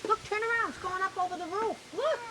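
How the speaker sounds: high, fast, excited pointing. Real, not acted. Excited pointing out bubbles to toddler.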